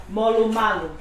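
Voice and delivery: ordinary talk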